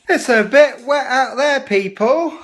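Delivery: loud announcement. Real, not acted. Announcing the rain to viewers.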